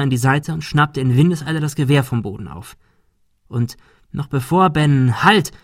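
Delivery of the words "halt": short, high cry to stop; shouted halt. A narrator's reading. Ben trying to stop the gunman, shouted. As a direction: cry out a warning.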